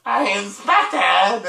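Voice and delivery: slow, drawn-out mocking holler; exaggerated imitation of a porch yell; buddies mimicking mother's yell. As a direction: tease a friend with his mom's stretched-out call. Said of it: "that's all they say to you".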